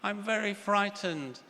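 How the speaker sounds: put-on high little child's voice calling to her mummy; frightened little girl. Little girl calls downstairs to mummy, high frightened child voice. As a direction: play a scared five-year-old calling for a cuddle. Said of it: "she calls down to the stairs to her mummy".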